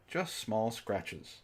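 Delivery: ordinary delivery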